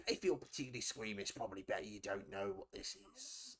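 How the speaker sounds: a shady trader warning under his breath; low secretive dealer voice. Acted.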